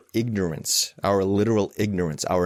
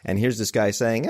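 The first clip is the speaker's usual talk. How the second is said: ordinary delivery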